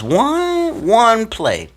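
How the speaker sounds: high, strained plea; pleading. Real, not acted. Player pleading with coach for one play. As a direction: beg for just one play. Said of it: "I'm like, how".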